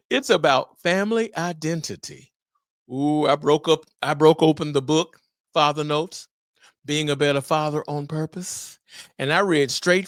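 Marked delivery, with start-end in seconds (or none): none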